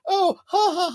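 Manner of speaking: hammed-up high giggling; mock giggle. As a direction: fake a silly giggle. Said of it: "rolling around laughing".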